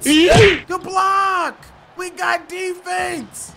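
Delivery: high, loud cheer on the block; excited gamer shout; hyped shout. Real, not acted. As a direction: celebrate the defense, stretching the words. Celebrating a block.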